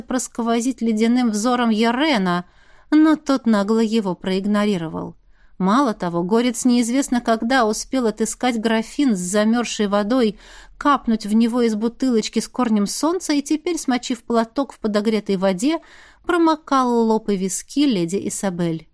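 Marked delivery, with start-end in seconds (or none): none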